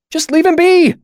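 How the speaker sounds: very high, loud cry; frantic, high-pitched shout; shrill plea. A narrator's reading. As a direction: plead in a shrill panic. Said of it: "Bast's voice was shrill".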